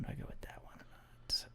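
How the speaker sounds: unvoiced muttered question; whispering. Real, not acted. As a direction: whisper to yourself, undecided. Pondering aloud.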